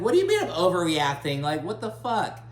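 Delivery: high, loud, indignant retort; mock outraged Kiki. Recapping Kiki snapping back at Julia, mock outrage. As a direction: fire back in pretend disbelief. Kiki answers being called overreacting.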